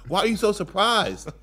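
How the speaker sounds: worked-up teasing comeback; mock exasperation. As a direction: tell him it's old news.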